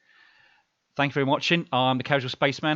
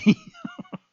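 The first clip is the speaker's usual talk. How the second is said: embarrassed laugh; 5 dB louder than usual. Real, not acted. Laughs after tripping over word.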